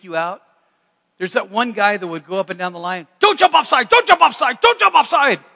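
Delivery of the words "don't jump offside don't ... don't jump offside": high, very loud repeated yell; frantic shouting. Retold or imitated. Football player warning his linemen, imitated frantic shouting. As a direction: yell it down the line like a worked-up player.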